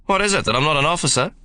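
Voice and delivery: a broad Australian soldier teasing. A narrator's reading. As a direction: lean on the Australian accent to needle him. Ben challenges the doorman.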